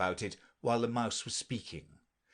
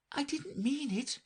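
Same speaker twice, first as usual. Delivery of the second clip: pleading. Acted. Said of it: "pleaded poor Alice".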